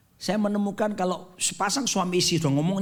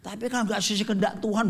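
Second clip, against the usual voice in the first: ordinary talk, nothing marked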